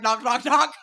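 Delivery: loud, high knock-knock; acted knocking. Correcting how the door was knocked on, loud acted knocks. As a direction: rap the words out like knuckles. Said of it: "No, I knock on the door".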